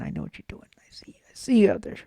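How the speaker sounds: playful, knowing whisper; conspiratorial whisper. Pretend. Host telling viewers he sees what they're doing, playful whisper. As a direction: whisper it as if you've caught them out.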